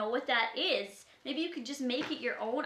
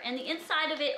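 ordinary talk

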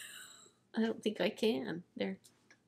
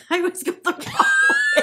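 laughing; 11 dB louder than usual. Real, not acted. Laughing at herself.

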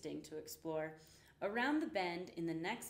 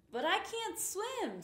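frightened; 12 semitones higher, 5 dB louder, slower than usual. A narrator's reading. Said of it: "said the little mouse".

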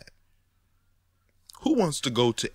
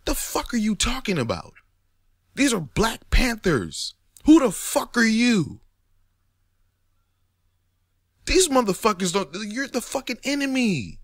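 much higher and louder, disbelieving; incredulous outrage; 11 semitones higher, 4 dB louder than usual. Real, not acted. Ranting at people who say [name] is dead, high incredulous yelling. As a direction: shout it like they've lost their minds.

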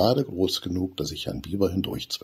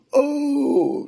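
high, much higher scared cry; frightened yelp; 6 dB louder than usual. A narrator's reading. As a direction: shriek as the broom speeds up. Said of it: "schreit Benny". (ängstlicher Schrei, sehr hoch)

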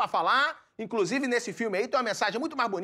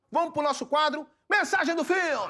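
announcer voice; 6 semitones higher than usual. Acted. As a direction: call out the segment title like a TV host. Announces the show segment.

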